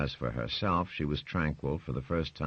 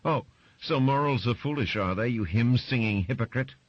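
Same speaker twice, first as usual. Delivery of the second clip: high, loud, sneering jab; scornful wife; 9 semitones higher, 5 dB louder than usual. Acted. Wife sneering at her husband over morals, loud and high.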